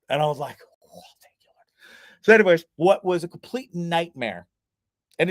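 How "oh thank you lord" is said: breathless whispered thank-you to the Lord; hushed grateful whisper. Retold or imitated. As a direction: whisper it like a relieved prayer. Relieved at praise from directors.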